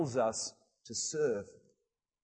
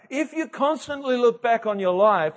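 preaching; 7 semitones higher, 6 dB louder than usual. Acted. Preaching at full force.

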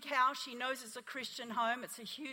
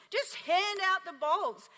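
emphatic repeat; 7 semitones higher, 6 dB louder, slower than usual. Acted. Preacher restating her command.

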